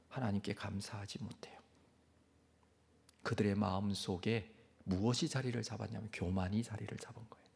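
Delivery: hushed, grave whisper; a solemn whispered confession of failure. Acted. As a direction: lean in and whisper the sin. Hushed sermon on Israel's ingratitude.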